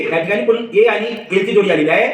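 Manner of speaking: ordinary talk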